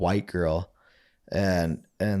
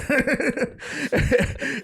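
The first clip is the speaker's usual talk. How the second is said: high laugh. Real, not acted. Laughing at Boo's remark.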